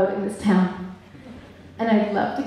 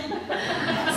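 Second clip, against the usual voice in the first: light amused laugh at her own joke; short laugh; 8 semitones higher, 6 dB quieter than usual. Real, not acted. Realtor laughing after joking to the council about buying land. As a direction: chuckle after the sales pitch.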